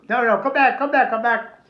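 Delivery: mock seller begging come back; calling back. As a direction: hurry him back.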